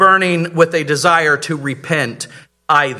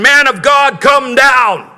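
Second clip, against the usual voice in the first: high, louder shouted command; shouting captain; 8 semitones higher, 5 dB louder than usual. Retold or imitated. Captain shouting up at the prophet, loud command. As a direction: bark the order down the mountain. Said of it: "So when he's shouting".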